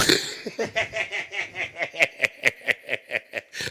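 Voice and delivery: high loud burst of laughter; big laugh. Real, not acted. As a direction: crack up laughing. Laughing at daughter's babble.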